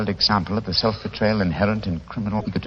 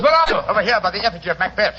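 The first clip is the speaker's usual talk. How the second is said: high, loud call across a dark room; urgent call in the dark; 12 semitones higher, 3 dB louder, faster than usual. Acted. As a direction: shout to find him. Calling out in the dark.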